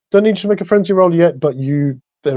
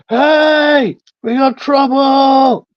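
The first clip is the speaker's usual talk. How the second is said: high, drawn-out call for help; calling out alarm; 11 semitones higher, much slower than usual. Acted. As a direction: call a slow warning to friends out of earshot. Staggering character calls out alarm.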